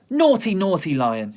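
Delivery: loud, telling-off voice; girl shouting at a lion; scolding shout. Acted. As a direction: scold the lion like a naughty child. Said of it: "she was shouting".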